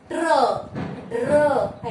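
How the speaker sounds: ट्र and ड्र with the र glued under, ड्र loud; tr and dr sounds. Shown as a demonstration. Teacher drilling pupils on ट्र and ड्र clusters. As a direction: say ट्र, ड्र as one tight cluster each. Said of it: "ऐसे इसका उच्चारण होता है".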